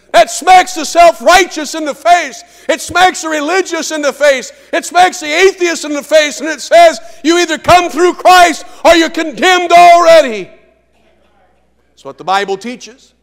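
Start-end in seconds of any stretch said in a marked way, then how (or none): 2.7-10.5 shouted, high, loud preaching; a full-throated pulpit shout; preaching at full force. Acted. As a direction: hammer it home like a revival preacher.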